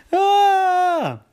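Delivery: high, loud cartoon scream; panicked scream. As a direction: shriek at the top of the panic. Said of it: "What's all the shouting?".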